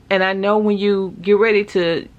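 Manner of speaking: ordinary talk, nothing marked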